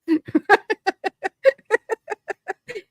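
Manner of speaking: high giggling laugh at herself. Real, not acted. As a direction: break into a high, airy giggle.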